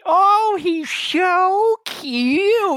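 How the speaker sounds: high, slow, weepy leading lady; high tearful diva voice; sobbing actress voice. Acted. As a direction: sniffle it out, high and fragile.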